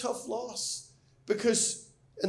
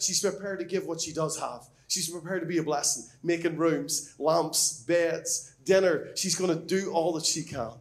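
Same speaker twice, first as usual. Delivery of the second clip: normal talking voice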